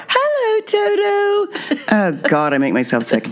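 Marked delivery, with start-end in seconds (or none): 0.1-1.8 very high squeaky mock greeting; self-parody, pitched way up into falsetto; put-on cutesy voice. Shown as a demonstration. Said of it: "and I have mine".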